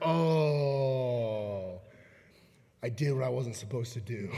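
long, sinking low groan of "oh", then heavy, low words; dawning regret. Retold or imitated. Peter realizing at the rooster's crow what he did, regretful. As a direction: let the realization sink in, voice dropping low.

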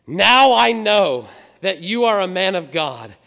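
booming, drawn-out declaration of faith; loud proclamation. Acted. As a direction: declaim it at full volume, pitched high. Widow's declaration to Elijah.

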